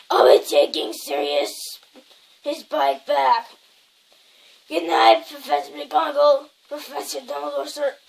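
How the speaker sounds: loud gruff giant voice; big booming Hagrid. Acted. Hagrid bids the professors good night, loud and gruff. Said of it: "that voice just makes me cough".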